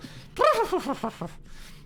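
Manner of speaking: high imitated whinny with a fluttering start; horse snort and whinny. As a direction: blow the lips, then neigh like a proud horse. Horse tosses mane proudly after hit.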